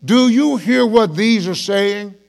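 loud, high indignant demand; acted outburst of offended priests. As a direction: demand it slowly and loudly, in outrage. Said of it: "They were indignant and said to him".

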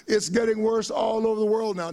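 normal talking voice

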